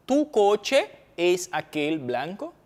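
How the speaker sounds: very slow teaching repeat. Shown as a demonstration. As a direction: drag "Tu coche es aquel blanco?" out, syllable by syllable. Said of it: "repeated slower for students".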